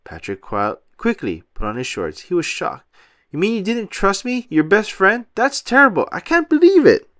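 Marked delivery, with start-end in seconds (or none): 3.3-7.1 climbing higher and louder into indignation; betrayed best friend working himself up; hurt outrage. A narrator's reading. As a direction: let the hurt build to a loud wail. Patrick upset at mistrust.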